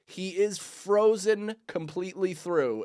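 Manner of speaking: high, slow, careful delivery; awkward confirmation. Retold or imitated. Confirming to Nicole's mom the cat is frozen, awkwardly high. Retold bad news to mom.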